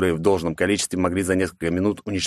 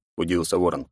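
usual speaking voice

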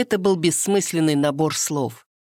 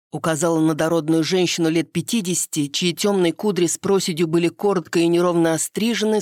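plain reading voice

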